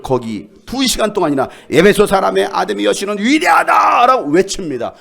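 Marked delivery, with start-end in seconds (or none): 1.7-4.3 loud unison chant; loud crowd shout of praise; group shout. Acted. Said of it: "모두 한 목소리로".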